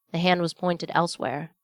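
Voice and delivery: plain reading voice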